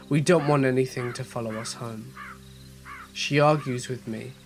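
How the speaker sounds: ordinary delivery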